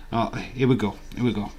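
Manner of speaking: ordinary delivery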